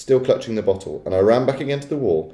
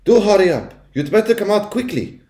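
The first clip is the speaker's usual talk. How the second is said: high, urgent grandmother call; shouted; 12 semitones higher, 3 dB louder than usual. A narrator's reading. Grandmother shouting for her grandson to come out. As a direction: call out high, hurrying someone along. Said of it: "it shouted".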